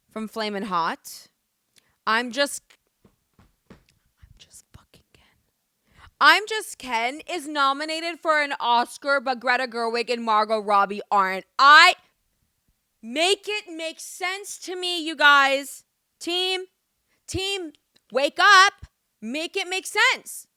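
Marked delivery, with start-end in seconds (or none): 3.7-5.2 hushed whisper of the swear. Real, not acted. Joking about the Oscar song, whispered. 11.6-20.5 much higher, drawn-out and pleading; exasperated plea. Real, not acted. Calling on viewers over the Barbie snub, exasperated.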